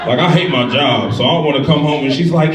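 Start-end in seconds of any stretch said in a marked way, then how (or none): none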